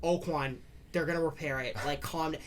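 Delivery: a hint of a fed-up grandma calming a crying kid; tired grandmother. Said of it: "my grandmother's like".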